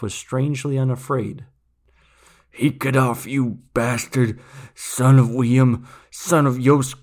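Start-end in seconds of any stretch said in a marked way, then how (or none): 2.5-6.9 put-on slow, louder, strained old man's call; dying old man calling. A narrator's reading. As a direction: summon the boy with his last strength. Dying man curses then calls boy.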